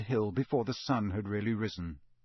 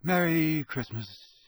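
vague Merry Christmas; 3 semitones higher, slower than usual. A narrator's reading. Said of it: "waved them off with a vague".

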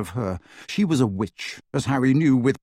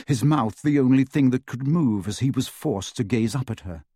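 usual speaking voice

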